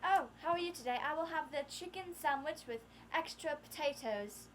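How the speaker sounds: put-on British accent, politely ordering food; acted British restaurant voice. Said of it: "we started talking like this".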